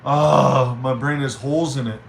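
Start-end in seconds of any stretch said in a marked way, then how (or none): 0.0-0.8 loud exasperated "ah"; frustrated groan. Real, not acted. Streamer blanking on what to say, frustrated groan. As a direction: groan loudly at your own forgetfulness.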